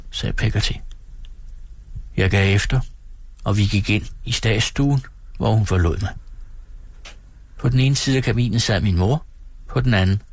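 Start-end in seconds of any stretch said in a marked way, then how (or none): none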